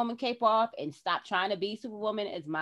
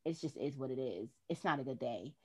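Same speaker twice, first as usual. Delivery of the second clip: weary admission; 7 semitones lower, 9 dB quieter than usual. Retold or imitated. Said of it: "real and say".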